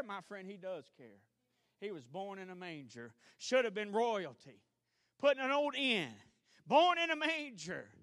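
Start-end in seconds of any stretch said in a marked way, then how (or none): 3.4-7.9 loud, high, slow preacher's cry; preaching climax. Acted. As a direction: lean into each phrase, slow and ringing.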